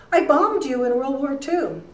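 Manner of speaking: lightly imitating an old German man's accent. Old volunteer confessing to the reporter, light German accent. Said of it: "In this German accent".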